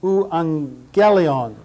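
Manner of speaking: Euangelion as oo-ahng-GEL-ee-on, very slow; slow Greek pronunciation. Shown as a demonstration. Teacher showing students the gamma-gamma NG sound, very slow. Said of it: "N G sound".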